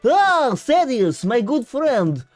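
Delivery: put-on Russian accent, theatrical and booming; hammed-up Russian accent. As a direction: greet the chatter in a big slow mock Russian accent. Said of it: "I am speaking in Russian".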